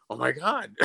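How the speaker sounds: blurted through a laugh; mock shocked cry, laughing; laughing oh my God. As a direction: laugh it out in disbelief. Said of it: "I heard another".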